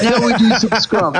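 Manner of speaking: mock chef. Giant chef voiced in story.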